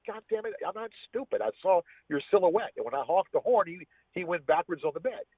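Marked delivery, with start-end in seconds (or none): 0.1-2.7 sharp high exasperated outburst, not shouted; retold exasperation. Man retelling catching his girlfriend, exasperated pitch jump. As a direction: fire it back at her in disbelief, voice jumping high. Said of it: "I said".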